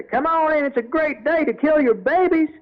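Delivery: high, loud Southern devil barker call; devilish Southern barker. Retold or imitated. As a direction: hawk it like a carnival devil in a Southern accent. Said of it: "in character as the devil".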